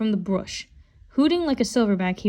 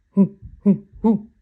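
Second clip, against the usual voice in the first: low hooting; 7 dB louder, slower than usual. Acted. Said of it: "he said in a low voice".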